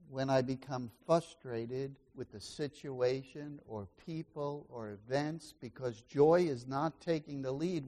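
ordinary delivery

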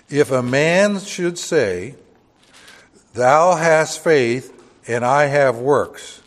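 slow, loud, weighty reading of the verse; measured King James reading; solemn scripture reading. Acted. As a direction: read it out like pulpit scripture. Reads scripture slowly and loudly.

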